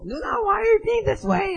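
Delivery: high-pitched acted complaint; arguing sibling. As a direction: complain to your sister in a high voice. Acted street argument with sister.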